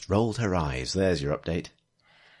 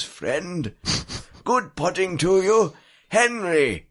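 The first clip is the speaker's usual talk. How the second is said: put-on higher, theatrical old storyteller voice; hammed-up old man recounting his woes to grandchildren; 4 semitones higher than usual. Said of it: "Old Man Storyteller by the Fire".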